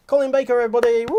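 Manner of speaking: loud showman introduction; big cheering welcome; announcer shout. Real, not acted. Host announcing guest loudly.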